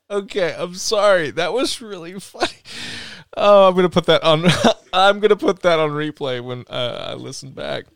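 high giggly voice while recovering; laughing. Real, not acted. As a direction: talk through leftover giggles.

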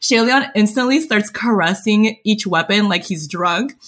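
ordinary talk

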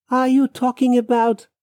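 high question left hanging; hesitant questioning. Acted. Man afraid to ask about her coffin, hesitant. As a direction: pitch up and leave it unfinished.

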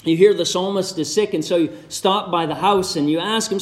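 normal talking voice

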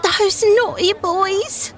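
put-on high little girl sobbing out slowly; a small girl crying out her hurt. A narrator's reading. Little Dolly sobbing to her father about the boys. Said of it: "she sobbed".

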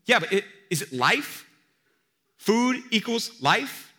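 loud, high, slow pointed question; a slowed, loud rhetorical jab; pressing question. Retold or imitated. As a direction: drag out food equals life to press it. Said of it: "preacher presses the audience".

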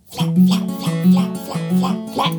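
breathy whispered flap flap. Shown as a demonstration. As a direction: whisper the flaps rhythmically. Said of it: "And she was flapping".

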